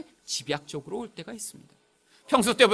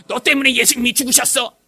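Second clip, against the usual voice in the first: loud, raised accusing cry; accusing shout; 5 semitones higher, 8 dB louder than usual. Retold or imitated. Evangelist makes summer Bible school children repent, accusing shout. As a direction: act out a preacher shouting blame at children.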